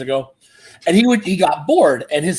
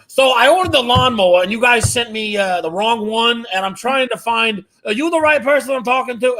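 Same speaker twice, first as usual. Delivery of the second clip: high, earnest confused customer act; dad baiting scammers; 6 semitones higher than usual. Retold or imitated. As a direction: imitate dad playing a befuddled caller, pitched up. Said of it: "and I hear".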